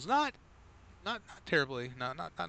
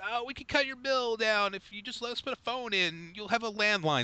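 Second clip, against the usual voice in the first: mock Comcast salesman; 13 semitones higher than usual. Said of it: "It's like".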